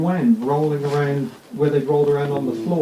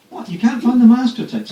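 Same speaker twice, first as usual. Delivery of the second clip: mock disbelief; 8 semitones higher, 4 dB louder than usual. Imitating people's disbelief at label.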